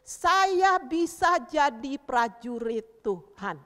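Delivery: loud, very slow, word-by-word chant; declaration to repeat. Shown as a demonstration. Teacher leading children in a pledge, loud slow declaration. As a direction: proclaim it for children to echo. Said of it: "Taruh bilang".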